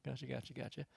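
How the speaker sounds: retold reply, dropped very soft; muted acknowledgement. Hunter privately noting another member's comment, very quiet. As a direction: repeat it quietly, as if to yourself.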